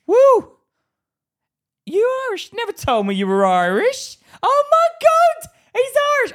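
high, loud, squealing excitement; mock thrilled reaction. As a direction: gush in over-the-top astonishment. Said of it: "when they'd find out I was Irish".

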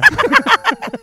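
high, loud burst of laughter. Real, not acted. Ending the improv scene, high loud laughter. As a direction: crack up loudly, pitch leaping up. Reacts to own bag line.

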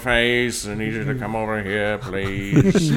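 lightly put-on drawling film role; a hint of a famous actor's delivery; Alan Rickman as Hannibal. Podcast host plays the recast team leader calling Face over, light impression. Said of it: "Alan Rickman as Hannibal".

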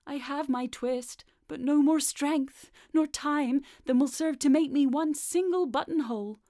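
a hint of a worried tailor; anxious old tailor. A narrator's reading. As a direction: fret aloud as the old tailor. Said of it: "said the tailor".

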